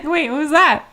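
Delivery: laughing through the question. Retold or imitated. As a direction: giggle it out, high and loud. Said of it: "I was like".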